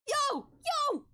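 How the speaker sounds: high, loud exclamations, spaced out; startled high-pitched shouts; shocked yelps. Acted. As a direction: yelp each one high in disbelief. Stunned reaction to game twist.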